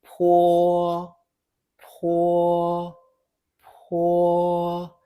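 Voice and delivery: "Po, po, po" held level with a puff on each p; aspirated "po" in a steady drawn-out tone; repeat-after-me drill. Shown as a demonstration. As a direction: sustain each "po" evenly, pausing between them. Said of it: "Repeat after me".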